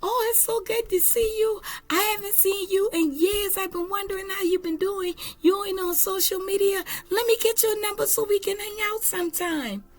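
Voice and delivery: put-on high, gushing hello to an old friend; excited greeting. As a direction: squeal it out like you just spotted her across the street. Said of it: "somebody riding by and say".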